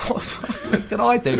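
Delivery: amused helpless protest; laughing exasperation. Retold or imitated. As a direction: throw up your hands, half laughing. Exasperated reply to boss.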